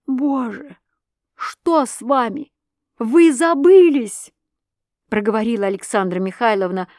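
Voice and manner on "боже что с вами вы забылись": high, slow shocked cry; stunned with fright; high, slow frightened reproach. A narrator's reading. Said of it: "помертвев от испуга".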